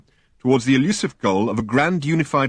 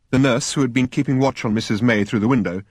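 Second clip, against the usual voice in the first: usual speaking voice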